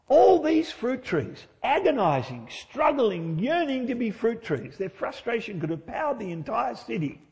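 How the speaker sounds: overdramatize the struggle as if in torment; mock agonized strain. Preacher imagining the racket of straining orchards, mock agony.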